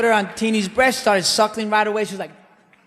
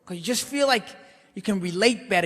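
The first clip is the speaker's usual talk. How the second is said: usual speaking voice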